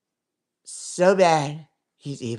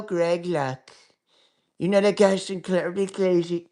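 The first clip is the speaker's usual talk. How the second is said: ordinary delivery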